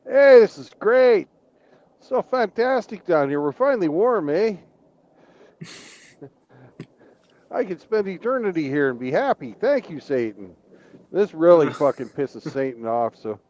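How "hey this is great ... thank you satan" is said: happy Canadians. Acted. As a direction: sound like a Canadian finally warm enough. Canadians thank Satan for warmth.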